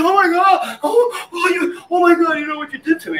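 high-pitched, hammed-up blubbering; overwrought, pleading mock cry; mock tearful. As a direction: imitate a traumatized young paramedic wailing. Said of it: "he's like, tears at".

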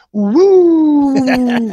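high falling siren whoop, drawn out; a long vocal siren wail; imitated police siren. Cop car pulling the driver over, imitated siren.

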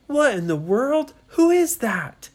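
loud, high disbelief; astonished. Acted. Children astonished at figures below.

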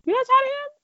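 high, disbelieving question; can't believe you're not tired; incredulous. Real, not acted. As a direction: ask it in shock.

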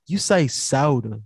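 SODA drawn out slowly as "sow-duh"; stretched-out soda. Retold or imitated. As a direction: imitate her slow, stretched "soda". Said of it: "she was stretching it".

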